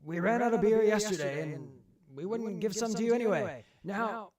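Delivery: high, loud shouted refusal into a command; Samuel. Acted. Samuel refuses beer and orders the cannon fired, high shout. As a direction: call it out high and forceful, ending on a battle order. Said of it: "you hear Samuel say".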